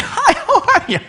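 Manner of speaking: very high chirpy laughing welcome; fake cheery greeting. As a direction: greet the visitor in a high sing-song. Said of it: "you're saying".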